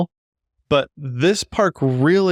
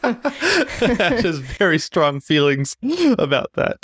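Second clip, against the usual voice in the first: laughing while talking; 5 semitones higher, slower than usual. Real, not acted. Teasing through laughter.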